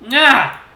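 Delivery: sharp high yelp of pain; pained cry. A narrator's reading. Fox cries out at his cut paw, sharp pained yelp. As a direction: cry out as the twig cuts. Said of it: "I cry out in pain".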